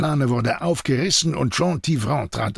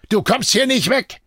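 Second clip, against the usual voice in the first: high, forceful forbidding; angry order; 11 semitones higher, 4 dB louder than usual. A narrator's reading. Jean forbids Antoine to leave, angry order. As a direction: stop him with a sharp command.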